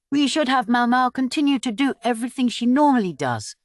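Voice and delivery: a hint of a stubborn court lady; lady-in-waiting voice. A narrator's reading.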